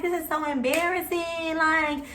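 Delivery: retold crying plea, rising to a high whine; sobbing to officers, scared of jail. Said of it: "So I'm crying like".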